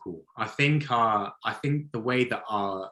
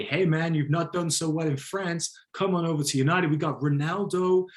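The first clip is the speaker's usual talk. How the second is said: mock American pitch; 5 semitones higher than usual. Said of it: "make that call to Poch and say".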